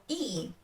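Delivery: "и" as a clear ee; и sound. Shown as a demonstration. Teacher quizzing the learner, "и" as isolated ee. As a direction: offer "и" as a separate vowel. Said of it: "what do you hear".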